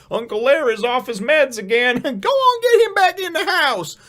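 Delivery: high, loud, fed-up yelling at an uncle; exasperated relative calling out. Pretend. Relative calling out to Uncle Larry, high and exasperated. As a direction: shoo him back indoors, way up high. Family herding relative back inside.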